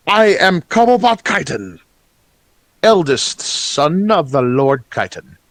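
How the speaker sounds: high, grandly formal goblin noble; pompous high-voiced emissary bearing gifts; goblin lord's son. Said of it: "he bows deeply with a flourish".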